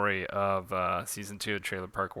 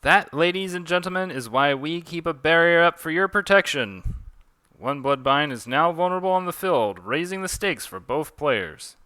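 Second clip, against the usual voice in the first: raised, projecting ringside voice; animated play-by-play commentary; match announcer; 7 semitones higher, 6 dB louder than usual. Acted. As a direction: address the crowd like an emcee. Addresses crowd as commentator.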